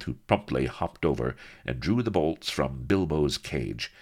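ordinary delivery

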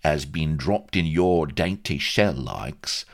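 high, emphatic Cockney character voice; cheeky Cockney agent. A narrator's reading. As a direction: testify for your client with Cockney bluster. Vladimir defends his client.

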